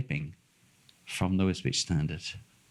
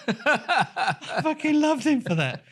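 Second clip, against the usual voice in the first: laughing delight; 4 dB louder, much slower than usual. Real, not acted. Laughing about the cop.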